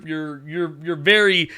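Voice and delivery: usual speaking voice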